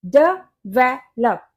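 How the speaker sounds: डेवलप broken up slowly as duh - VEL - up; syllable by syllable. Shown as a demonstration. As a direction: spell out डेवलप in three slow beats. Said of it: "ये होता है डेवलप". (डेवलप को धीरे तोड़कर, 'ड-वे-लप')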